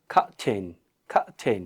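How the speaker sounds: "খা ঠেন" split in two, both consonants aspirated; aspirated syllables. Shown as a demonstration. Teacher modelling the word for students, "খা ঠেন" in aspirated syllables. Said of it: "এটা একটা aspirated sound".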